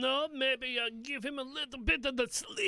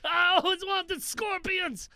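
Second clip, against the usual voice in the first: high, slow gleeful cry; thrilled shout of delight; excited; 5 semitones higher, 4 dB louder, slower than usual. Acted. Gleeful at finding scorpions.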